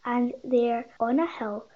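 plain reading voice

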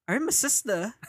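high mock British accent. Retelling the stranger's question, in mock British accent. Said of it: "With accent yan".

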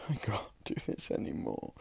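whispered, drained, with a little laugh; tired whisper. Real, not acted. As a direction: breathe it out like you're done. Exhausted, giving up mid-recording.

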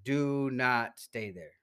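slow, drawn-out warning; emphatic warning. Real, not acted. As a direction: stretch every word out to warn them off. Emphatic warning repeated to listeners.

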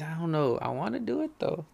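ordinary talk

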